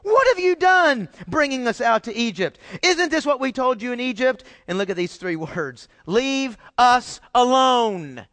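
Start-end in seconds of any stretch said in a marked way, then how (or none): 0.0-4.4 high, raised-voice mock complaint; unhappy complaint. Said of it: "That doesn't sound very happy". 6.0-8.2 slow, high mock complaint; unhappy complaint. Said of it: "That doesn't sound very happy".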